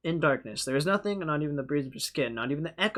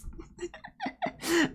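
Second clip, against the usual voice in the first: high laugh. Real, not acted. Laughs after outburst.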